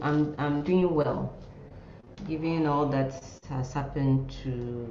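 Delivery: ordinary talk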